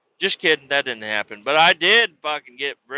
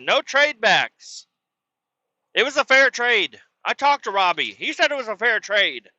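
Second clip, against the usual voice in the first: shrill scolding mom; 9 semitones higher, 4 dB louder than usual. Retold or imitated. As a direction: lecture him like an angry mom. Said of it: "She said".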